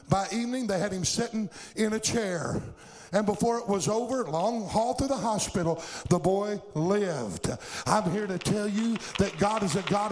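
fervent revival preacher in full cry; full-force preaching. Real, not acted. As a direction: preach it hard, driving each phrase to the congregation.

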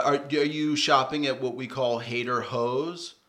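usual speaking voice

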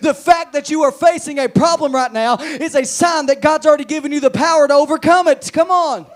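high, loud, driving exhortation; impassioned revival preacher; full-force preaching. Real, not acted. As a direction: build to a shouted pulpit climax.

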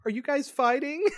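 very high, singsong question; mock mom voice. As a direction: imitate a mom walking in on a quarrel.

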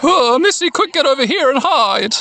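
high, urgent creature voice; Numemon voice. Acted. Numemon tells Missy to hide, urgent creature. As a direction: urge her over as a high little monster. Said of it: "I like the Numemon voice".

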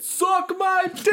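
high, drawn-out holler; slow, high-pitched mock shout; retold yell. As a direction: imitate a worker bellowing across the plant. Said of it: "and just yelled".

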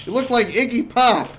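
loud mock taunt; shouted retold insult. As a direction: hurl the insult loudly, as retold. Acted insult to the bartender.

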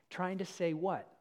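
ordinary talk, nothing marked

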